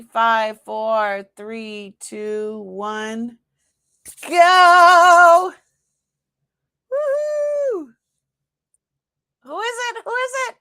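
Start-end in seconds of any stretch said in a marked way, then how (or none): 4.3-5.5 high, loud shout of go; excited shout. Real, not acted. Host to live viewers, starting the draw with an excited shout. 6.9-7.9 high whooping cheer; excited whoop. Real, not acted. Host to live viewers, cheering the draw with a high whoop. 9.4-10.6 high, eager questions; eager excitement. Real, not acted. Host waiting for the winner, asking with high eager excitement.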